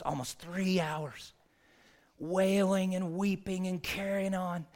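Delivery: lightly imitated Southern funeral director, drawing out the long words; thick Southern accent. Funeral director telling his pastor about a long service, Southern accent. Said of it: "imagine a thick Southern accent".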